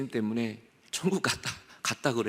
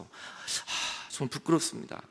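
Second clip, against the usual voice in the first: ashamed reply; 6 dB quieter, much slower than usual. Acted. Sinner ashamed.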